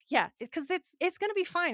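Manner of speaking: ordinary talk, nothing marked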